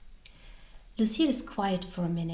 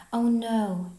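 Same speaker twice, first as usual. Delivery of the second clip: louder, higher cry of dismay; mild shout; 4 semitones higher, 7 dB louder than usual. A narrator's reading. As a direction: exclaim it in alarm, without yelling. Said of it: "Abigail shouts".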